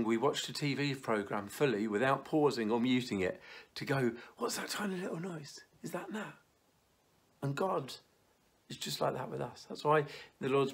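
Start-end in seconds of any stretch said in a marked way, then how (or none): 4.4-6.3 high, hushed sudden alert; new parent hushing dinner guests, high and hushed; alarmed parent. Retold or imitated. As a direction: freeze and listen hard for the baby. Parents pausing the TV.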